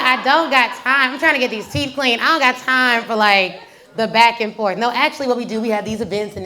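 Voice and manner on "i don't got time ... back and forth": loud, emphatic mock complaint; exasperated retort. Dental patient with no time to explain, exasperated. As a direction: push out each word, comically fed up. Retold fed-up reply in dental chair.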